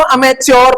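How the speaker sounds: "अमेच्योर" with a च्योर ending, र sounded; mistaken pronunciation. Shown as a demonstration. Teacher quoting how some people say it, "अमेच्योर" with a full -yor. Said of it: "पता नहीं क्या क्या बोलते हैं".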